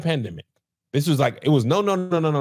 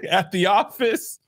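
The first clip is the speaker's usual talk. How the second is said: high, slow laugh breaking into tears; laugh-crying; slower than usual. Real, not acted. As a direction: laugh through tears, slowly.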